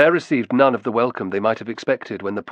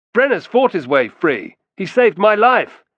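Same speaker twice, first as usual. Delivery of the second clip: raised, high, insistent defence; heated protest; 10 semitones higher, 7 dB louder than usual. A narrator's reading. Romulus defends Brennus to the hostile sentries, heated protest. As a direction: plead the case loudly. Accused man defends friend to accusers.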